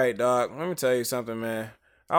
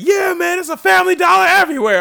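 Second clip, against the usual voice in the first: high, loud mimicking shout of bus riders; hammed-up yelling from the back of the bus; mock yell; 11 dB louder than usual. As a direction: bellow it across the bus like the rowdy passengers. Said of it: "yelling at each other".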